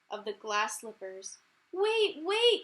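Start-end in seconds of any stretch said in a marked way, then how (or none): 1.7-2.6 high, loud calling after her; crying out. Acted. The prince calling after fleeing Cinderella, loud high cry. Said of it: "cried the prince".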